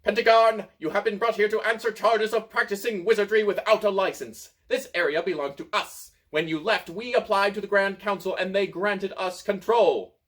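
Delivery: high, loud, self-important voice; tiny Rubus accusing Pentegarn, self-important; puffed-up tiny wizard. A narrator's reading. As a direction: pump up a small man's pomp. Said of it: "puffs up his chest and says".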